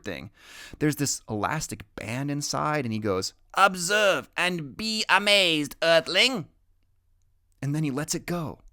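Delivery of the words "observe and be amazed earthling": higher, slow and louder, falling on Earthling; grand announcement. Acted. Boy genius shows off his bird, grandly proclaiming. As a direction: proclaim it like a showman alien.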